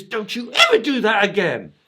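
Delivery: high, loud furious owner shout; angry shout. Retold or imitated. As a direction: bellow at the dog in a fury. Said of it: "he shouts and screams".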